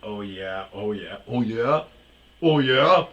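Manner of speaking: mocking imitation. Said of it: "hearing his".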